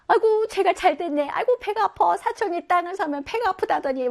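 high, loud, whiny mock complaint; jealous grumbler. Teacher hamming up a jealous relative, high and whiny. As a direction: whine like a jealous cousin. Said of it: "뭐 이런 거 들어봤어요?".

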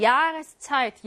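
"야스차이트" slowly, with a long stressed 야; stress on first syllable. Shown as a demonstration. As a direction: lean hard on 야 in "야스차이트", taking it slowly. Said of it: "얘가 일 강세네".

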